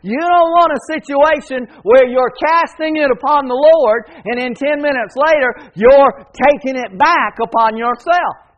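high, loud revival preaching; fervent preaching. Acted. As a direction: preach it out to the whole room. Preaching at full volume.

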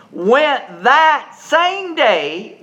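"went that same day" drawn out very slowly; slow emphasis. Real, not acted. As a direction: stretch each word for the congregation. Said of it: "What same day?".

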